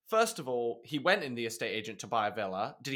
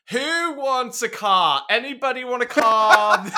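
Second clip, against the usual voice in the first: put-on drunken offer; 9 semitones higher, 10 dB louder than usual. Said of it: "six pints later".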